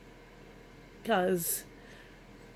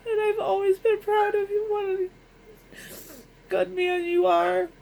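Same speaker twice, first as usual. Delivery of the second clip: crying; 10 semitones higher, 4 dB louder than usual. Real, not acted. Tribute to late husband, weeping.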